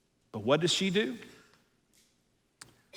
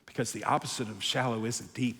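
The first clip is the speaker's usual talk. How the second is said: quiet emphasis; 7 semitones lower, 8 dB quieter than usual. Real, not acted. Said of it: "quiet point before whisper".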